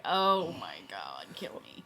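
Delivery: low, drawn-out mock despair; exasperated groaning quote. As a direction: drag it out low, imitating someone fed up. Exasperated reaction quoted in a story.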